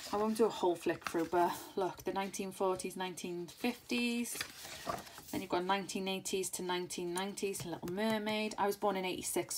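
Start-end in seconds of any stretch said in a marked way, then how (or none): none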